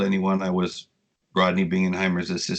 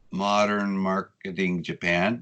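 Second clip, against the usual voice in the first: "Modern Marketing Japan" slowly, word by word; slow, careful naming; slower than usual. Real, not acted. Guest names his blog for listeners, "Modern Marketing Japan" said slowly.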